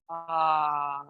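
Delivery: soft exhaling sigh, open mouth; dramatic sigh. Shown as a demonstration. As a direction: let the breath go on ah. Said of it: "open your mouth".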